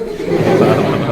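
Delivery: sudden burst of laughter; loud laugh. Real, not acted. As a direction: break into laughter. Teacher laughs during demo. (ज़ोर की हँसी)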